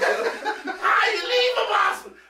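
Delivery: very high, drawn-out squealed protest; laughing mock outrage. Asking James how you leave a possum, laughing outrage. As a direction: wail it high through laughter.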